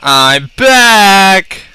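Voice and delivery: high, loud announcement; triumphant shout. Pretend. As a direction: belt it out, stretching the last word. Announcing a return in the game.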